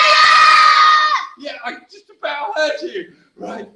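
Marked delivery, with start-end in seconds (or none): 0.0-1.3 very high, full loud yell; big shout. Shown as a demonstration. Storyteller leads the children in a loud high shout. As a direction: belt it out to lead the crowd. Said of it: "shout it so we can hear you".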